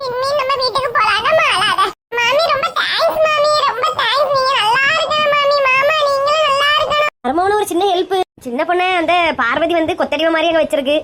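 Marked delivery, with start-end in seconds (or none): 2.2-7.1 1 tearful thanks. Acted.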